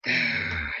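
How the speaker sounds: very low, growling demon voice. Real, not acted. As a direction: growl it out from deep in the throat. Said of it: "don't judge my demon voice".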